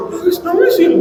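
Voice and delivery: gasp, then put on a little kid's cry; fake crying like a child. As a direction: pretend to bawl like a small child. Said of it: "Kayak anak kecil, anak kecil kan".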